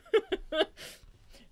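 high-pitched giggle after a slip; real giggling. Real, not acted. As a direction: crack up at yourself. Laughs at misreading.

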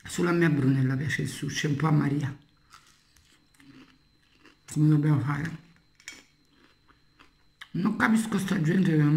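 talking while chewing food; muffled chewy speech between bites; mouth full, chewing. Real, not acted. Seen 4: Holds claw throughout, bites at 9.3, chews while talking after.

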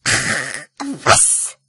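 toneless screechy rat outburst; kreischende Ratte. Acted. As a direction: spit it out as an outraged rat. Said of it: "kreischte die Ratte". (kreischend empörte Ratte)

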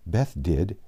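usual speaking voice

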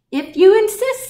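high, loud bird voice; high-pitched storybook bird; sparrow. A narrator's reading. Sparrow answers the challenge.

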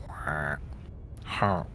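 usual speaking voice

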